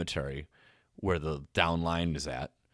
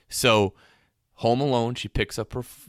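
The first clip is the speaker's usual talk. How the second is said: usual speaking voice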